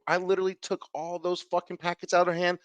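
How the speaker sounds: ordinary delivery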